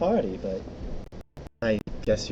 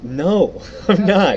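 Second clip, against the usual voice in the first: laughing; 8 semitones higher, 10 dB louder, much slower than usual. Real, not acted. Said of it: "you're not a professional voice actor".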